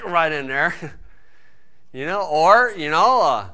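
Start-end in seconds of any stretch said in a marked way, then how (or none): 0.8-1.9 soft laugh. Real, not acted. Laughing at his own joke.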